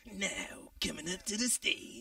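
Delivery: slow, drawn-out club emcee voice; strip club announcer voice. Shown as a demonstration. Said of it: "do the strip club voice".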